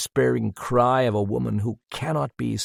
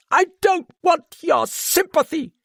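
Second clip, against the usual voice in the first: much higher, tearful, cried out against sobs; fighting sobs; 6 dB louder than usual. A narrator's reading. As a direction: hold back the sobs while crying out. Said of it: "Madame cried, fighting those sobs".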